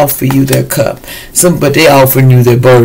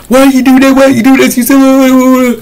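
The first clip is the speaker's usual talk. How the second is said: high-pitched scolding rant; hammed-up nagging tirade; mock chewing-out; 11 semitones higher, 3 dB louder than usual. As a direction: imitate someone chewing somebody out, pitched way up. Acts out someone chewing somebody out.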